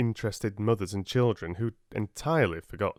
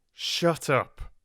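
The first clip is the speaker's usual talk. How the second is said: a touch of fierce command; 7 semitones higher, 4 dB louder than usual. Acted. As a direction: cut him off hard. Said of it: "so fiercely that even Finn shut up".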